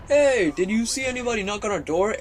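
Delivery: high-pitched mock neighbor voice; neighbor calling out. Neighbors call down to kids after a knock, calling out.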